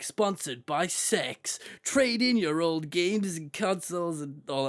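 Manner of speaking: slow, drawn-out announcer read. Retold or imitated. Reading a sponsor line aloud.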